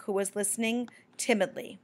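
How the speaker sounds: plain reading voice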